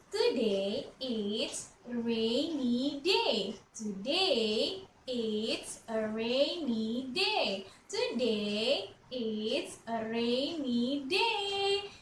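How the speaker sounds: slow drawn-out model sentence; slow teacher model. Shown as a demonstration. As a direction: say it slowly for kids to repeat. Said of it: "say what I say".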